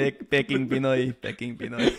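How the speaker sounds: chuckling through it; laughing at the joke nickname. Real, not acted. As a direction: say it half laughing. Joking nickname while laughing.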